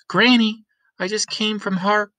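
put-on bright young girl calling out; girl Lena.

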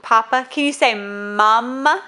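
ordinary talk

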